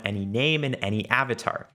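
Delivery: normal talking voice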